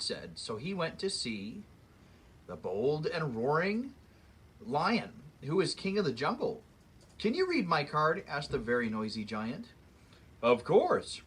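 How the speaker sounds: lively read-aloud storybook voice; story narration. A narrator's reading. Reading a fairy tale to children, lively storyteller voice. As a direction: read it like story time for kids.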